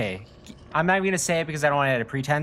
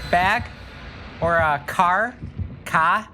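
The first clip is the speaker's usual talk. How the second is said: put-on Boston accent; 5 semitones higher, much slower than usual. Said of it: "J F K say".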